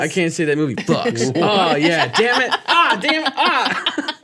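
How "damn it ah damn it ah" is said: high laughing groan. Real, not acted. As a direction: laugh it off, exasperated. Laughing at own swearing.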